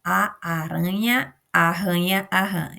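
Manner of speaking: slow careful tongue twister; teacher demonstrating a tongue twister; tongue twister, slowly. Shown as a demonstration. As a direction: say "A aranha arranha a rã" slowly, tap in "aranha", strong guttural r in "arranha" and "rã". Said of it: "trava-língua".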